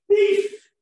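high shouted call of beef; yelled answer. Retold or imitated. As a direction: shout it out from the back. One guy yelled out.

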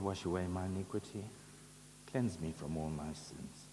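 usual speaking voice

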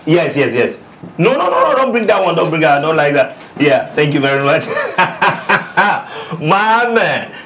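bubbling over with glee on the phone; excited call. Acted. Man phoning a friend after the yes, loud laughing glee.

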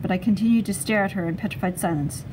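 usual speaking voice

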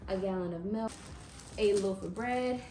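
ordinary talk

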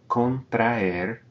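Contraer with ae split apart; syllables separated. Shown as a demonstration. Teacher repeats Contraer, vowels apart. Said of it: "we're separating that aer".

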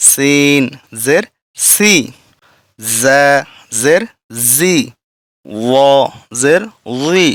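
slow chanted spelling with pauses; recite-along drill. Shown as a demonstration. Teacher asking students to read along, steady chant. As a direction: lead the class through it syllable by syllable. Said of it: "আমার সাথে সাথে পড়ার চেষ্টা করেন".

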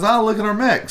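high imitated Chinese-accented sales greeting. Booth worker inviting a customer to look, mimicked Chinese accent. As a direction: mimic her Chinese accent, pitched well up. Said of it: "That's how sh-, she said it".

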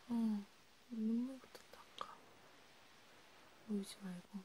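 hushed, half-whispered comfort to a crying friend. Acted. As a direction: soothe them gently, close to the mic.

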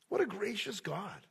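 usual speaking voice

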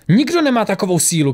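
mild high-pitched outcry; crying out. A narrator's reading. Tora, her punch caught by Lisbeth, cries out high. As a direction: exclaim it high, short of a full shout. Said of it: "křikla Tora".

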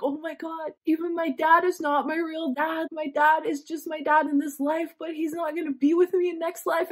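high, near-breaking voice reliving the moment; tearful realisation. Retold or imitated. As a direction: let the voice climb as the tears come. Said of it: "And I started crying".

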